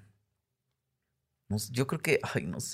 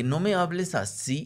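mild scolding; 7 semitones higher than usual. Retold or imitated. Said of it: "le dije".

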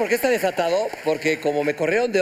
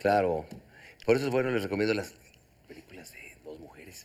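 strange low voice; 10 semitones lower, 8 dB quieter than usual. Pretend. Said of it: "¿Y por qué hablas así?".

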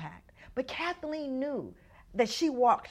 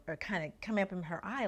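normal talking voice

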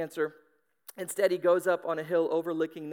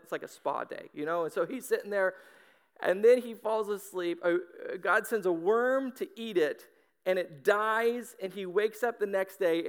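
usual speaking voice

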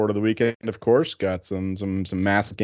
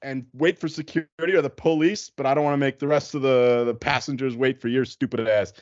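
driver going off; 5 semitones higher than usual. Retold or imitated. Passenger imitates bus driver threatening to call police, going off then fading. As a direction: start raised, ease down to the closing insult. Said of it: "driver just starts going off".

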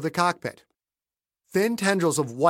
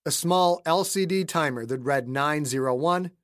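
usual speaking voice

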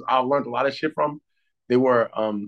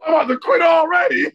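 loud, high, half-laughing blurt; exasperated outburst; 12 semitones higher, 6 dB louder than usual. Real, not acted. Joking to friends about quitting after two weeks, loud exasperated blurt. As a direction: throw it out like you're done already.

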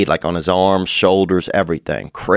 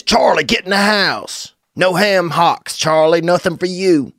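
higher, slow mock bossing of a dog; mock bossy owner; 10 semitones higher than usual. Comic mocking a dog owner ordering his dog, higher mock bossing. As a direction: play the petty owner barking orders. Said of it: "Do this".

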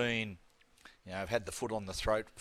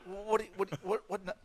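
mock disbelief; 9 semitones higher than usual. Retold disbelief at the upgrade.

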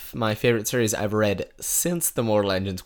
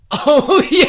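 laughing; 13 dB louder than usual. Real, not acted. Laughing recall of lamp magic.

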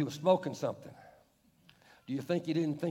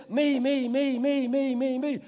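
mocking me me me; 6 semitones higher, 6 dB louder, slower than usual. Mocking selfish talk.

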